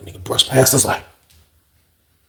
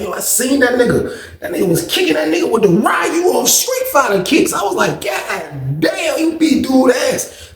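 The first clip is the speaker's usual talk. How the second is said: excited laughing shout; 10 semitones higher than usual. Retold or imitated. Hyped telling old head he beat dude.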